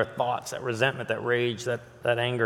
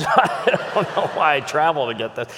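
laughing. Real, not acted. Joking aside with laugh.

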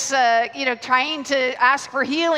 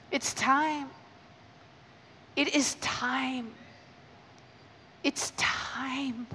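very soft and slow; hushed prophetic urgency; hushed declaration; 8 dB quieter, much slower than usual. Real, not acted. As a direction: lean in and murmur it.

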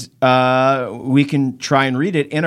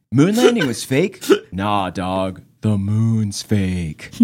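lower, slow standard American read; deadpan it in standard American; regular American accent; 5 semitones lower, much slower than usual. Shown as a demonstration. Host rereading the listener's email, regular American accent. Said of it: "in American regular accent".

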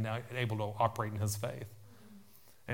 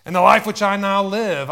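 much louder and higher, shouted scripture; forceful proclamation; 7 semitones higher, 15 dB louder than usual. Real, not acted. Preacher declaring scripture to the church, forceful shout. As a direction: belt out the verse at full force.

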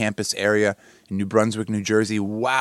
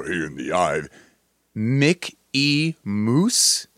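dramatic announcer; 2 semitones lower, much slower than usual. Pretend. Host mocking a mascot bio, hushed trailer voice. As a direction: voice it like an inspirational film narrator. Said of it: "and I go".

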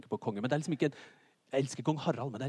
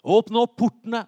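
louder, higher town-crier call; heralding the king; 8 semitones higher, 10 dB louder than usual. Acted. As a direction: call out news of the king's arrival. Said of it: "så hører du det at kongen kommer". (utrop om at kongen kommer)

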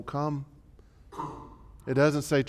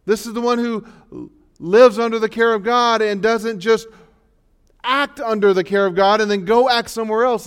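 loud, higher preaching voice; impassioned preaching; 7 semitones higher, 9 dB louder than usual. Acted. Pastor pressing the church to live under God's care. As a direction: drive the point home with force.

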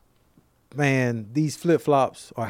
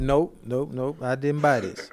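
ordinary delivery

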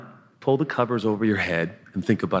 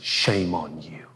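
scolding; 4 semitones lower, much slower than usual. Retold or imitated. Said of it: "We have a saying in our culture".

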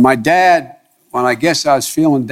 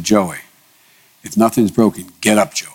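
ordinary talk, nothing marked

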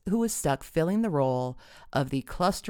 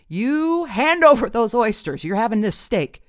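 booming; 8 semitones higher, 10 dB louder than usual. A narrator's reading. Dad orders daughter to give up oysters, booming. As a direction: boom out the order. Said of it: "boomed her dad".

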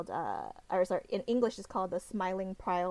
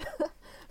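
breathy giggle; 9 semitones higher than usual. Real, not acted. Laughs about cosplaying him.

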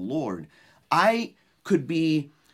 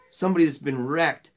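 ordinary talk

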